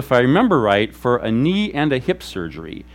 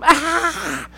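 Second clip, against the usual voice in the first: sudden high mock scream; retold scream; 12 semitones higher than usual. Pastor imitating a woman screaming during worship, high mock scream. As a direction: mimic her short piercing cry, much higher. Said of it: "a woman about a number of rows away who just screams".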